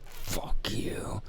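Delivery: under-the-breath whisper; seething whisper at a boss being nice; whispered curse. Retold or imitated. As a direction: hiss it silently in your head. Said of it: "but I was like".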